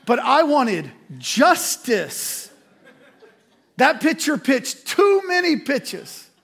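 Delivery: confessing his petty justice, slow and laughing; mock righteous. Real, not acted. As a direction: drag it out with a laugh, loud. Comic emphatic confession.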